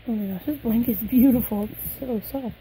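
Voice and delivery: ordinary delivery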